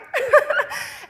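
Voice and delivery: quick high laugh at herself; bright burst of laughter; short laugh. Real, not acted. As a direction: laugh off the memory. Laughs at memory.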